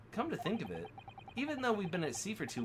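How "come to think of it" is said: normal talking voice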